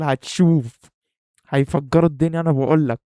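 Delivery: loud confident bragging; insistent boast. Acted. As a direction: hammer the prediction home. Insisting the channel will explode.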